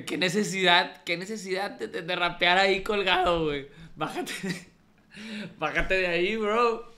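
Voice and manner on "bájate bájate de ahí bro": riendo. Real, not acted. Le dice riendo que baje.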